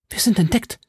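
high, frightened gasp of words; alarmed. Acted. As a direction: panic rising in her voice. Milady erschrickt. (Milady erschrocken, ängstlich hoch)

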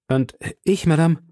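hesitant, embarrassed protest. A narrator's reading. D'Artagnan answering Madame's reproach, embarrassed. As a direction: falter a little, caught out and pleading. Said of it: "erwiderte D'Artagnan verlegen".

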